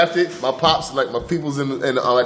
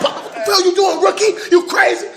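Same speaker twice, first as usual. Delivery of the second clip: angry veteran. Retold or imitated. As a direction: yell at the rookie, half laughing. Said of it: "He started".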